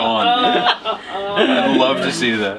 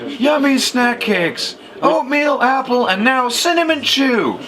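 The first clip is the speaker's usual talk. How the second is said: hammed-up product pitch; Billy Mays pitch; 7 semitones higher, slower than usual. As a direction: sell it like a shouting TV pitchman. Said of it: "Billy Mays for Yummies".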